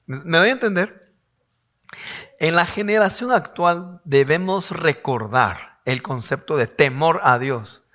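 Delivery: normal talking voice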